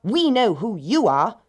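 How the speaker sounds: defiant little pigs; cried out. A narrator's reading. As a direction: call it out knowingly to the wolf. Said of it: "cried the pigs".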